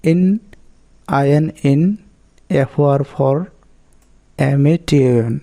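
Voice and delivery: "ইন", "for" and "এমএটিএন" set apart by pauses; spelled syllable by syllable. Shown as a demonstration. Teacher breaking a four-syllable word, "আইএন ইন" and "এফওআর for" spelled out in separate pieces. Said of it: "এটা হচ্ছে চারভাবে উচ্চারণ".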